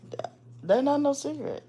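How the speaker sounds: put-on high little boy insisting; child's voice. Scene: little boy insisting to the woman, high child voice. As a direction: pitch it up like a stubborn kid.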